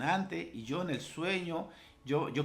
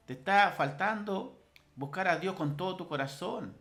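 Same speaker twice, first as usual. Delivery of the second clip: ordinary talk, nothing marked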